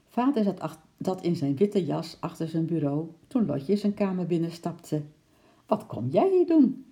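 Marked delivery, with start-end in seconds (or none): 5.7-6.8 high, loud surprised question. A narrator's reading. Father surprised to see Lotje walk in, high and loud. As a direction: sound caught off guard. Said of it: "vroeg hij verbaasd".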